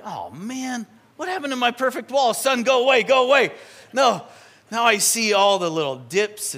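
hammed-up frustrated homeowner; mock exasperation. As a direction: shoo the sun away in playful annoyance. Mock frustrated wall owner.

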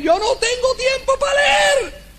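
a mock shout, told to Fody; shouted excuse. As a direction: belt out the excuse with indignation. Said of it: "le dije a Fody".

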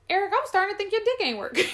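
high, fast mock outburst; excited stepmom. Stepmom teasing Eric at the news, mock excitement. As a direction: rush it out in a high imitation.

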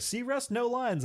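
high, slow mock character voice; movie quote. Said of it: "he's like".

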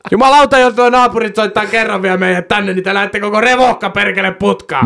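high, angry police threat; mock angry threat. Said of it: "ihan vitun vihasena".

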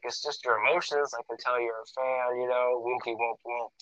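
very soft, soothing aside; quiet reassurance. Retold or imitated. Boxer comforting a tearful fan, quietly reassuring. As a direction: imitate someone kindly hushing their voice to console.

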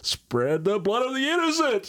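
slow, high eerie chant voice; mock satanic backwards lyric; spooky backmasked lyric. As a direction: intone it like a hidden evil message.

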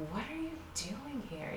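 normal talking voice

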